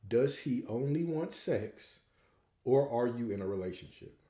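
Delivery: slow, deliberate question with each word spaced. Shown as a demonstration. Coach repeating the question to a listener, slowly. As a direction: spell the choice out slowly. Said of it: "I'm gonna say it slower".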